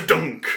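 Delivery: vocal sound effect of scraping, then a thunk; mouth-made rattle and clunk; imitated clunk. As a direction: make a mechanical rasp ending on "dunk". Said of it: "you hear the".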